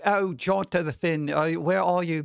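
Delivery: a slow, lightly put-on British accent; mock Winona Ryder's British accent, badly. Mocking the actress's stiff line, in a bad British accent. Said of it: "that was supposed to be a British accent".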